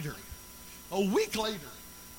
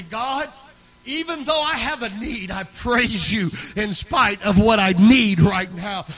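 raised, fervent cry to God; loud praise to God; 2 semitones higher, 14 dB louder, slower than usual. Retold or imitated. Worn preacher crying out to God in his bedroom, loud fervent praise. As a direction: call out praise, building to full voice.

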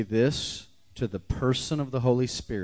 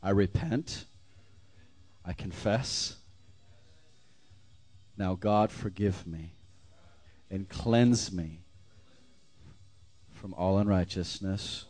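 leading a prayer; slower than usual. Acted. Preacher leading the church in a prayer of repentance. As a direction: pray it aloud over the congregation.

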